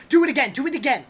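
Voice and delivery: put-on eager, insistent kids' demand; children going wild. Children begging for another magic trick, excitedly demanding. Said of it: "They go wild".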